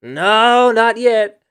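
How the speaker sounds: very high, loud drawn-out shout; Ben Hildebrand. Acted. Answering a viewer's question with an impression, high drawn-out shout. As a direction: belt it out high, far above normal talk. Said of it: "say it like Ben Hildebrand".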